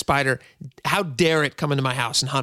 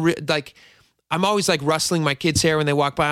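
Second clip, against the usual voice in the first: normal talking voice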